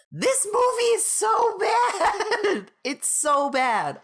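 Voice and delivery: high shout breaking into laughter; laughing shout. Real, not acted. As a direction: yell it while cracking up. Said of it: "I'm just gonna yell at the camera".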